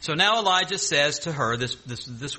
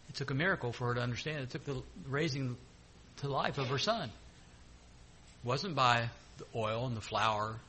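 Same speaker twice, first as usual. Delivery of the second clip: soft reflective preaching; 4 semitones lower, 11 dB quieter than usual. Real, not acted.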